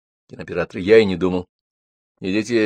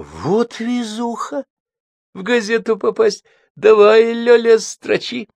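mock excitement; 12 semitones higher, slower than usual. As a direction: gush over it sarcastically. Женька дразнит статьёй о себе. (насмешливый восторг)

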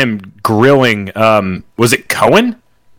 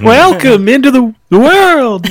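put-on high squeaky old man voice; Ron Paul squeaky voice; 4 dB louder, slower than usual. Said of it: "Ron Paul's squeaky voice".

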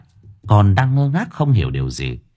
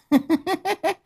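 very high, brief witchy cackle; crazed cackle; 4 dB quieter than usual. A narrator's reading. Grandmother taunting the little girl, shrill cackle.

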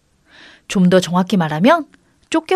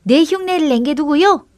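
higher echo of a call; mimicked shout; 6 semitones higher than usual. A narrator's reading. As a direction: mimic the indignant call, pitched up. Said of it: "또 흉내내는 소리가 들려왔습니다".

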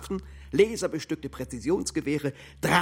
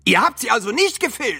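loud, high accusing question; furious they skipped the search; alarmed shout; 8 semitones higher, 9 dB louder than usual. Acted. Comrade berating the others, alarmed high shout.

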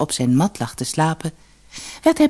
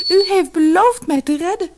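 very high, louder lament; plaintive wail; 14 semitones higher, 5 dB louder than usual. A narrator's reading. Lotusbloem reminds Tao of his promise, wailing plaintively. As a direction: reproach him in a high, pleading wail. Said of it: "klaagde ze".

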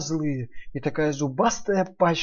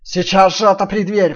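loud wolf growl, faster; 5 semitones higher, 10 dB louder than usual. Acted. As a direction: growl the order again. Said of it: "прорычал опять волк".

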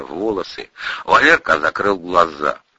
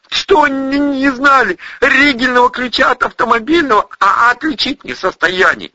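high, loud, stammering tirade; worked-up high-voiced stutterer; agitated stuttering soldier; 4 dB louder than usual. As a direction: rage at him while tripping over words.

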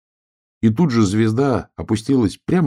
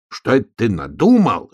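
raised, louder exclamation; would-be shout; 5 semitones higher, 6 dB louder than usual. A narrator's reading. Donkey protesting to armed bear cub, shouting. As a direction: cry out in protest. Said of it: "хотел крикнуть ослик".

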